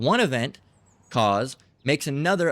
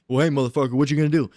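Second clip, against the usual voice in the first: louder, confrontational challenge; acted aggressor; 5 dB louder, faster than usual. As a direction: play someone itching for a fight.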